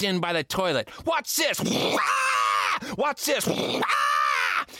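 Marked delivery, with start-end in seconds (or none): 1.1-1.6 high, showing-off call; excited kid shout. Acted. Boy showing off to friends, high and excited. 1.6-2.8 playful high yelp as if sucked down; high mock scream. Boy playing at being flushed, high squeal. 3.0-3.5 high, showing-off call; excited kid shout. Acted. Boy showing off to friends, high and excited. 3.5-4.7 high fake scream; playful yelp. Boy playing flushed, high yelp.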